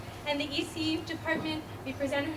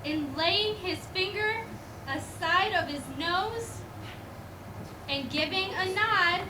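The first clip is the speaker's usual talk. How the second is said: steady storybook recitation; poem reading; 3 semitones higher than usual. Acted. Reading the poem to the school audience, storybook recitation. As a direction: read the old Christmas poem aloud. Reads Christmas poem aloud.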